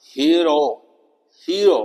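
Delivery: हीरो said hee-uh-ro; pronunciation demo. Shown as a demonstration. Teacher showing the vowel sound in हीरो. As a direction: split हीरो into ee and uh. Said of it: "ये आवाज आनी चाहिए".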